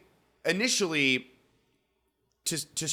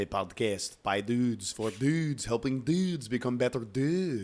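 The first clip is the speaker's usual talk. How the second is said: slow, lightly put-on French intro voice; a hint of French, drawn out; mock French accent. Answering his co-host in a mild French accent. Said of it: "Why are we French?".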